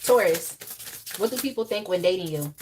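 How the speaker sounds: ordinary talk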